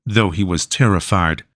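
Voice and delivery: usual speaking voice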